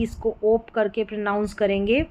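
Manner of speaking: ordinary talk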